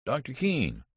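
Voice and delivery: high, urgent hail; calling out. A narrator's reading. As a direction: call after the doctor, pitch leaping up. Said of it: "he called".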